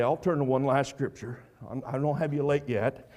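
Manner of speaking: normal talking voice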